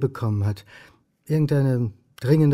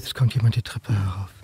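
tense whisper; 4 semitones lower than usual. Acted. Man noticing footsteps on the stairs, whispered. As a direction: breathe it so nobody hears. Hears someone coming.

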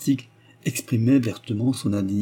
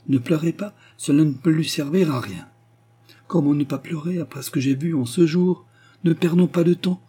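higher, urgent, rallying voice; urgent exclamation; 6 semitones higher, 3 dB louder than usual. A narrator's reading. Anna rallies the weeping pastor to act, urgently. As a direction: cry out to get things moving. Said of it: "s'écria-t-elle en se remettant".

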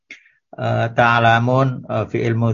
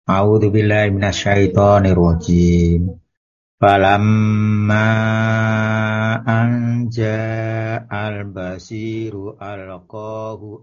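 Quran recitation. Real, not acted. Quran recitation before far part.

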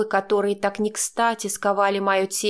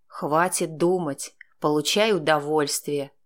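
smirking man; 4 semitones lower than usual. A narrator's reading. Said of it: "усмехнулся он".

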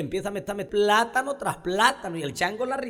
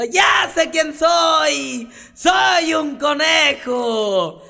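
loud, high, slow triumphant cry; excited shout; 10 semitones higher, 5 dB louder, much slower than usual. Acted. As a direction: shout out the discovery. Rabbit shouting in joy. (conejo gritando feliz)

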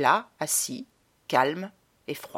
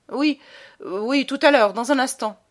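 hurried, higher brush-off; uneasy, flustered; 7 semitones higher, 6 dB louder than usual. A narrator's reading. Baron nervously putting off the boy, flustered and higher. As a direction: fluster and try to put him off. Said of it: "se sentit mal à l'aise".

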